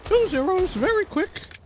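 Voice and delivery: high, fast, far-off impression of the caster; mock impression. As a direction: ape his cheerful prediction in a squeaky voice. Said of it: "accurate impression as well".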